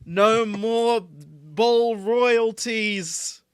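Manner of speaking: high, loud, slow rally chant; loud protest shout. Acted. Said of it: "protest chant".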